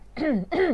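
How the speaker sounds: a nervous little throat clear. Shown as a demonstration. Teacher demonstrating a nervous throat clear for students. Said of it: "แบบการที่เราแบบกระแอมอย่างเงี้ย".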